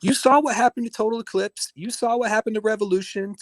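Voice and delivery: high mock voice, lecturing. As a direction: pitch it up like a smug host. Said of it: "And he's like".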